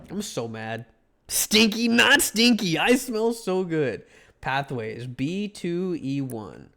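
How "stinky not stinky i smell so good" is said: higher, louder, slow insistence; mock indignant. Player insisting to the game he smells good, high and indignant. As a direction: protest you smell great. Retorting to game's smell remark.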